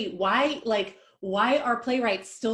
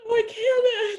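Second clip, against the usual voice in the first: distressed; 14 semitones higher, 4 dB louder, slower than usual. Acted. Refuses, upset.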